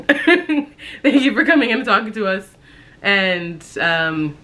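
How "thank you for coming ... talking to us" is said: laughing. Retold or imitated. Mocking doctor's polite goodbye.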